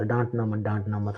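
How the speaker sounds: soft, whiny mimic of a timid student; hushed mock plea, sing-song rise; mocking whine. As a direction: repeat it like a scared kid begging. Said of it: "दिमाग ही खा गया यार".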